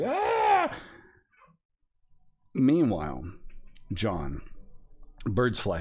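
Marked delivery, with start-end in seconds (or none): 0.0-0.7 high yelp of excitement; startled yelp. Retold or imitated. Dobbs reacting to the praise with a high yelp. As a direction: squeak out a surprised ah. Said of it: "And Dobbs is like".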